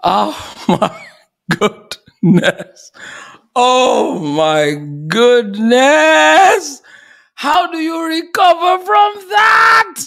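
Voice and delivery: loud, drawn-out amazed shouting; overwhelmed exclamation. Real, not acted. Host to audience after a moving talk, overwhelmed shout. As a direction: belt it out in stunned awe, word by word.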